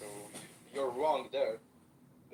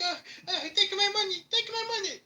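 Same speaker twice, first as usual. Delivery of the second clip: high, louder pleading squeal with a laugh; mock begging; 6 dB louder than usual. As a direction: ham up a terrified man begging. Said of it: "He's like".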